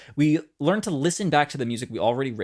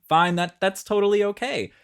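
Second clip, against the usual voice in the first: "Fine" higher and brighter, reassuring; upbeat reassurance; 6 semitones higher than usual. Acted. Coach telling students it's okay, upbeat "Fine". Conceding a silly idea works.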